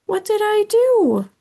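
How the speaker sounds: very high, loud worried squeak. Acted. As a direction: squeak it in alarm. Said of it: "I squeaked".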